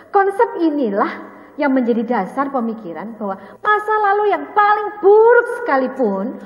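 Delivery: loud, insistent preaching voice; emphatic preaching. Real, not acted. Preacher pressing the class to believe the past is redeemed, loud and emphatic. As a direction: drive each phrase home with force.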